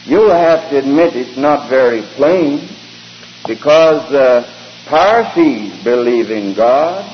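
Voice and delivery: usual speaking voice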